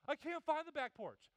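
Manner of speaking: high, loud whine; kid whining loud. Retold or imitated. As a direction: call back like a kid. Kid calling out.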